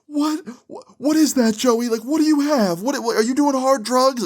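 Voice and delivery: very high, panicked mom impression; imitating a tearful, frantic mom; mock hysterical crying. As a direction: squeal it loudly like a mother freaking out. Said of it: "Joey's mom starts crying immediately".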